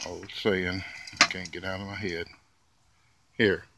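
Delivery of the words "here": usual speaking voice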